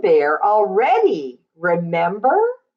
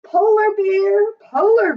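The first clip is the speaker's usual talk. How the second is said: sing-song refrain; 14 semitones higher than usual. Acted. Read-aloud refrain to child.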